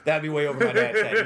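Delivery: ordinary talk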